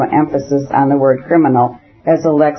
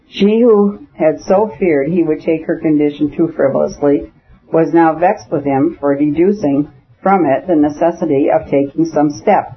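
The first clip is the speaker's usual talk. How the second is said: usual speaking voice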